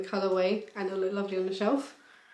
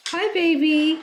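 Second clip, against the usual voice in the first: high, slow cooing praise; 3 semitones higher, 4 dB louder, slower than usual. Real, not acted. Playing with the baby.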